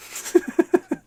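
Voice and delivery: breathy, voiceless giggle; short chuckle. Real, not acted. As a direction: chuckle at a corny joke. Laughs at a chemistry joke.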